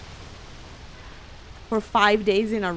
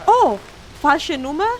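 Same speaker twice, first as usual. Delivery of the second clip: surprised; 6 semitones higher, 7 dB louder than usual. Retold or imitated. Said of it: "he was like".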